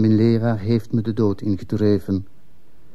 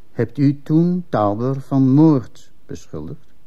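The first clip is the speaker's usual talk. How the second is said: very slow, weighted question, pitched up; pointed interrogation; 4 semitones higher, slower than usual. Acted. Commissioner asks witness about a murder accusation, slow and pointed. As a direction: spell out the grave question deliberately.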